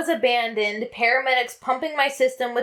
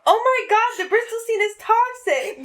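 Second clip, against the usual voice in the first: mock panic; 10 semitones higher than usual. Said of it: "I'm just gonna start saying that".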